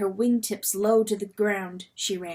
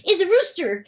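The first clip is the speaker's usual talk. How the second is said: raised, urgent weasel voice; weasel confiding; 9 semitones higher, 6 dB louder than usual. Acted. Weasel confiding to Lady Hen, high and urgent. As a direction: lean in and tell the secret urgently. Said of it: "he confided to her".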